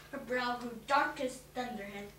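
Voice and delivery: usual speaking voice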